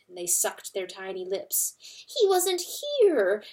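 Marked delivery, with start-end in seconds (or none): 2.1-3.4 high, anxious little mouse voices; frightened mice. A narrator's reading. Terrified mice begging the hen for news, high and anxious. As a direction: squeak it out like scared mice.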